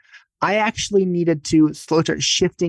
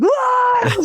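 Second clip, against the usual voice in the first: yelp; 3 dB louder than usual. Retold or imitated. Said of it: "involuntarily out loud yell".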